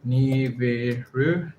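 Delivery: "Nibiru" drawn out as nee-vi-roo, rising; slow questioning pronunciation. Shown as a demonstration. Learner checking a word, "Nibiru" slowly sounded out as a question. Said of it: "check if that's a correct way of pronounce".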